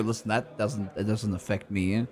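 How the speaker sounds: ordinary talk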